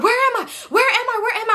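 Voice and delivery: very high, frantic repeated cry; high-pitched reenacted panic; panicked mock scream. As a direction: imitate yourself screaming in confusion. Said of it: "And I started screaming".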